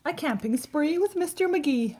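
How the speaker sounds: put-on bright, lilting title read for kids; storybook title. Parent reading title aloud to children, lilting. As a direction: announce the book's title to young listeners.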